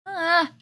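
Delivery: high, loud startled cry; sudden frightened shout; startled yelp. Real, not acted. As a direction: yelp as something jumps out. Mob appears suddenly.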